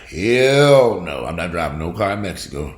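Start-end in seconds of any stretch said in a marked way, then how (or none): 0.1-1.3 loud, higher hell no; emphatic refusal. Real, not acted. Vowing never to drive in Mexico, loud emphatic refusal. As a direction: refuse it outright.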